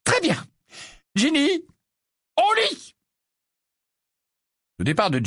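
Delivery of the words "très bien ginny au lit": ordering mother. A narrator's reading. Mother orders Ginny to bed.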